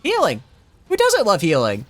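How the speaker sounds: high excited squeal; delighted squeal. Real, not acted. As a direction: pitch way up with glee. Delighted at the healing.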